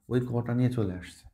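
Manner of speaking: ordinary talk, nothing marked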